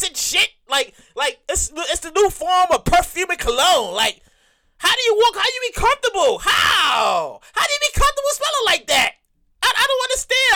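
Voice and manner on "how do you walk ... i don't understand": high incredulous rant. Real, not acted.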